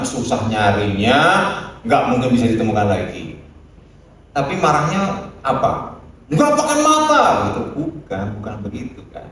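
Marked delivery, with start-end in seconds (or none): 6.3-7.2 high mock-angry snap at the child; angry parent yell. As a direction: scold the kid who broke the vase, much higher and a bit louder. Angry parent over broken vase.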